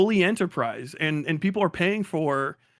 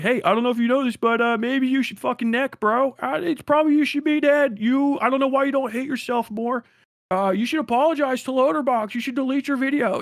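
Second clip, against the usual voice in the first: higher, mock-friendly troll voice; fake-polite hater, pitched up; sarcastic hater; 8 semitones higher than usual. A hater comments on the video, fake-polite higher voice. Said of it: "it's just like".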